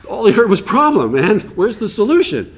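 exasperated inner voice. Retold or imitated. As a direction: throw up your hands in disbelief. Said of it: "you're like".

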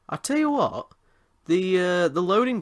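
sudden high-pitched burst; excited exclamation. Real, not acted.

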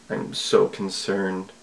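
quiet, slow mock concern; deadpan sigh of fake worry; dry sarcasm; sarcastic. Real, not acted. Sarcastic reaction to the threat.

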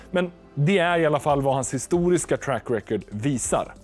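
normal talking voice

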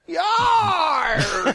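pirate yarg. Acted. Pirate-style outburst.

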